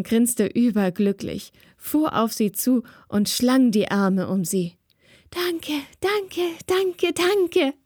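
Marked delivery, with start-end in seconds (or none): 5.3-7.7 higher squeaky thank-yous, overjoyed; squeaking thanks. A narrator's reading. Spirit girl hugging Yasin, squeaking her thanks higher. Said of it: "quietschte der Berggeist".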